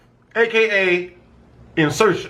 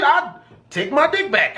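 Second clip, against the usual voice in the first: ordinary talk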